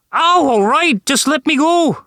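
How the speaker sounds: high, strained begging; pained pleading. A narrator's reading. The leprechaun begging Arnold to release him, in pain. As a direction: plead to be let go.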